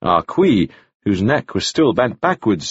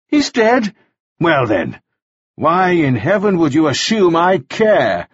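higher-pitched sneering contempt; venomous; 6 semitones higher than usual. A narrator's reading. Mannering voices the commissioner to taunt Ah Suk, venomous. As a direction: pour scorn into mock questions. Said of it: "This venom was unprecedented".

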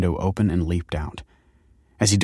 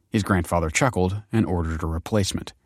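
ordinary delivery

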